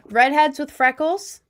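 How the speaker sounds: light up at the idea; excited. Real, not acted. Streamer gushing over redheads to chat, excited.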